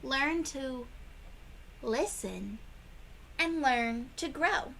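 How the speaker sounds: ordinary delivery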